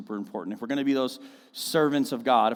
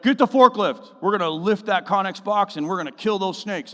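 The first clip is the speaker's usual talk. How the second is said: imitated boss order; 8 semitones higher, 8 dB louder than usual. Boss calling on the Nextel.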